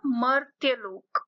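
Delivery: मर्त्य लोक said slowly, r right after म; careful slow demo of मर्त्य लोक; slow model pronunciation. Shown as a demonstration. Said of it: "तो ये क्या बोला जाएगा".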